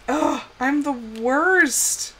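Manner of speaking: ordinary delivery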